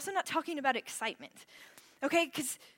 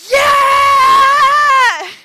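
much higher, much louder yell; team yell; 13 semitones higher, 25 dB louder than usual. Retold or imitated. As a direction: scream it with the whole cabin. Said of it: "And they go".

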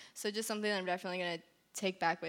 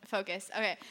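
ordinary delivery

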